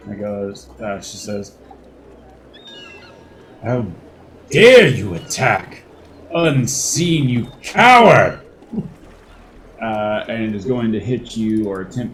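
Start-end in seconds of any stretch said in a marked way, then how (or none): 3.6-8.4 furious shout at a coward; angry villain. Acted. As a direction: spit the insult out loud. GM voices angry villain attacking.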